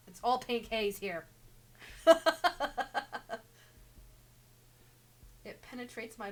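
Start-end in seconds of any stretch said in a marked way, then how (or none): 1.3-4.4 breathy laugh. Real, not acted. Laughs at her own joke.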